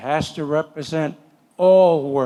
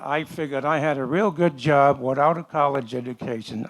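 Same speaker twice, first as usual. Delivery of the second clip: ordinary talk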